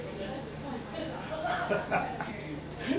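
high, breathy mad giggle rising at the end; sly lunatic giggle; giggling madman laugh. Acted. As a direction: giggle like a madman pleased with himself. Madman laughs at own cunning.

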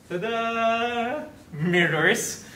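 high, drawn-out ta-da; playful reveal. Pretend. Host unveiling the studio mirrors, playful reveal. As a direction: sing out a showy little fanfare.

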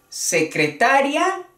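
"secretaria" stressed on TA, ending -ria as one syllable; high rising "secretaria", stress se-cre-TA-ria; acento en TA. Shown as a demonstration. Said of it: "la acentuación". ("secretaria" con acento en TA, subiendo al final)

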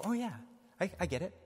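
very high, sudden aha; catching the flaw in the line; mock realization. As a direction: pretend it just clicked.

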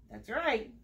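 ordinary talk

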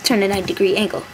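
quick flat instruction; rattled off fast. Pretend. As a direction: rush through it. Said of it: "And then you'd be like".

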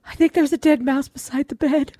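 spooked confession; a hint of a scared wife. Wife telling husband about the mouse, timid high voice.

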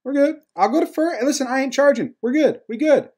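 high-pitched, breezy reassurance; nonchalant batter imitation. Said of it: "and just says".

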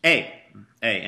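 ordinary talk

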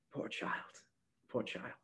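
sighing, breathy whisper; half whisper. A narrator's reading. Granny half whispers with pity to herself. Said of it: "she half whispered".

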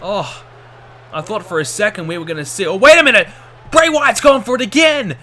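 excited, loud play-by-play shouting; wrestling commentator. Acted. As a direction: call the finish like a ringside announcer. Live match play-by-play.

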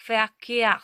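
"Verkehr" loud, starting with f, long stressed second syllable; German V as F. Shown as a demonstration. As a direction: model "Verkehr" as fer-KEH-ah, falling. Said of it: "вона читатиметься як звук Ф".

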